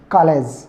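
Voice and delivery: कॉलेज with ऑ between आ and ओ; कॉलेज, the open ऑ sound; correct pronunciation. Shown as a demonstration. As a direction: say कॉलेज with lips slightly spread. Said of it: "क्या बोलेंगे?".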